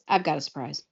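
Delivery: soft, low, absent-minded delivery; understated distracted murmur; a bit absent. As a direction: say it lightly, as if barely attending. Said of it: "a bit absently and says".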